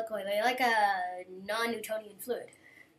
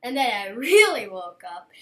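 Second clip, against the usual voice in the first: loud laugh through the words; laughing loudly; 10 dB louder than usual. Real, not acted. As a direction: laugh out the line. Laughs mid-line.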